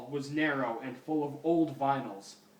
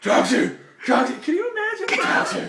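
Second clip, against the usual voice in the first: loud, high taxi shout through laughter; calling out; 12 dB louder, faster than usual. Acted. Batman in the fanfic hails a taxi, laughing loud and high. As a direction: hail the cab, cracking up.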